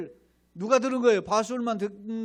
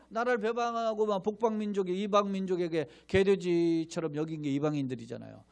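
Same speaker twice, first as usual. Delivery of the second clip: ordinary delivery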